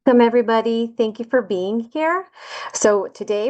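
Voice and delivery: usual speaking voice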